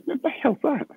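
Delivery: sudden high startled question. Retold or imitated. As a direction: caught off guard by a voice in your ear. Hearing a strange voice on air.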